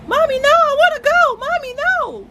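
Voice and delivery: put-on very high, whining little-girl voice; imitating a clingy toddler; toddler pleading. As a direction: beg like a small child who won't let go. Voicing toddler crying to leave.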